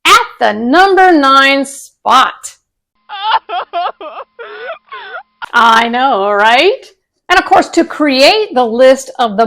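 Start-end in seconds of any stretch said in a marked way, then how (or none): 3.1-5.2 laugh. Real, not acted. Laughing at own reveal.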